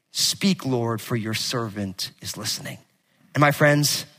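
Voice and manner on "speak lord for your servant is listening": soft and breathy, dropping much lower; lower, soft whispered prayer; hushed prayer. Acted. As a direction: breathe the words like a quiet prayer. Eli's counsel to Samuel.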